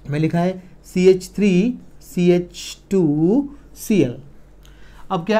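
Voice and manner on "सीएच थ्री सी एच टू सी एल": very slow, letter by letter; slow dictation. Shown as a demonstration. Teacher writing the second example, slow dictation. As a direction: spell the formula out as you write. Teacher writes a formula aloud.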